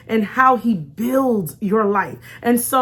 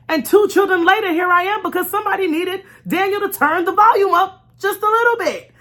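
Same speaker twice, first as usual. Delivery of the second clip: excited testimony; 7 semitones higher than usual. Real, not acted. Mother testifying about her two children, excited. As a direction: let the wonder lift the voice. Testimony climax, rising emphasis.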